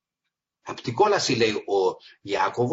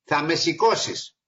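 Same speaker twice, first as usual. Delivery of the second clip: joking reply; 6 semitones higher, 5 dB louder than usual. Retold or imitated. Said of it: "λέω".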